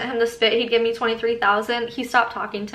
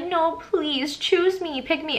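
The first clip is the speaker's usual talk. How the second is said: mock pleading; 5 semitones higher, slower than usual. Mocking how he wanted her to beg, higher mock pleading. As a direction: mimic a girl begging to be picked. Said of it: "trying to make me be like".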